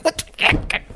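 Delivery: very high, breathy disbelieving squeak; incredulous squeak. Retold or imitated. Incensed pilot to co-pilot, very high squeak.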